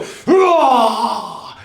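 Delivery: loud high hurrah cry; battle yell. Shown as a demonstration. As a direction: shout it charging into battle. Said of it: "Okay, now go".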